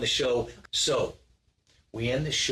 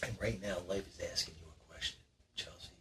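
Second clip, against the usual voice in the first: quiet, intense build-up; hushed dramatic; 4 semitones lower, 12 dB quieter than usual. Acted. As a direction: drop to a near whisper. Host builds a hushed, dramatic mood.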